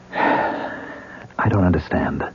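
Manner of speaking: slow, sighed, half whispered; sighing, drained confusion; weary sigh. Acted. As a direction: let it fall out on a tired breath.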